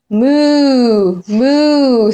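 drawn-out lowing moo; cow moo. Acted. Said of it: "said the cow".

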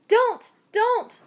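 high, loud urgent "don't"; urgent plea. A narrator's reading. Mister Yi stopping the woman at the river, high loud plea. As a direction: stop her from running off. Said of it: "said Mister Yi".

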